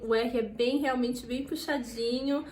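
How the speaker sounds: ordinary talk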